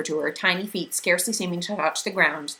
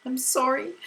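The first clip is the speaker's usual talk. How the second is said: higher, tearful apology; sobbing; 8 semitones higher than usual. A narrator's reading. Meg apologizing to Calvin, mildly sobbing. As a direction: cry it out. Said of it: "she sobbed finally".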